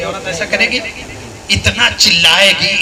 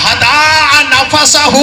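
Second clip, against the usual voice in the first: हदा नफसहू drawn out very slowly, high and loud; हदा नफसहू called out, each syllable stretched; 9 semitones higher, 5 dB louder, much slower than usual. Shown as a demonstration. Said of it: "क्या कहा?".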